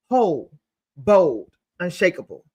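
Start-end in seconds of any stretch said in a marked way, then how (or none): none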